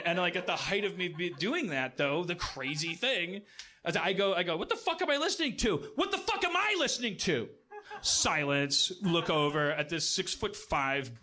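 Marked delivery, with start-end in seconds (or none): 4.6-7.5 high, fast, exasperated outburst; mock tantrum. Telling the host how he snapped at the TV, as a mock tantrum. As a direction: throw a little hammed-up fit. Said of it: "throwing this little tantrum".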